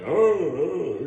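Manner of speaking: high, drawn-out dazed moan; mock monster moaning; Frankenstein's monster groan. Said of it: "He's like fucking Frankenstein's monster".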